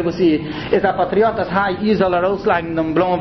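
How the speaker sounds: ordinary talk, nothing marked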